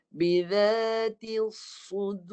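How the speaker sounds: "الصُّدُورِ" with a solid, heavy sad; teacher modelling the verse; recitation demo. Shown as a demonstration. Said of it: "Dengar saya baca sekali lagi".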